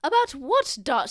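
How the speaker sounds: very high sing-song noble lady. Acted. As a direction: ask it like a doting grand lady. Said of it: "Lady Gwynth voiced".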